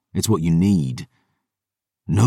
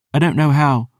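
put-on higher, guarded young woman; sulky girl voice; 9 semitones higher, 3 dB louder than usual. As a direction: answer warily. Dior's girl voice.

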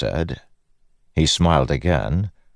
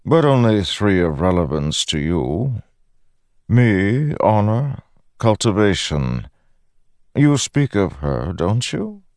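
higher-pitched, measured god voice; Odium, lofty god; 7 semitones higher than usual. A narrator's reading. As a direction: enumerate grandly, then probe. Voices Odium listing the gods.

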